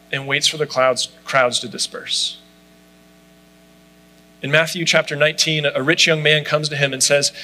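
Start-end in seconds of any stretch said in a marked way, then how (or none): none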